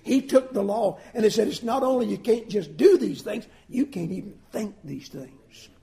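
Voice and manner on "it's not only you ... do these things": usual speaking voice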